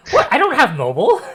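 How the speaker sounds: high, loud disbelief; surprised protest. Real, not acted. Game master to a player about having mobile, startled loud protest.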